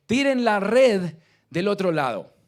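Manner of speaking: loud command, called from afar. Acted. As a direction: order them loudly to cast again. Jesus commands from the shore.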